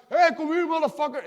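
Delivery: loud, high taunting yell; picking a fight at full volume; aggressive shout. Acted. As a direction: bark it out to start a fight. Provoking the enemy before war.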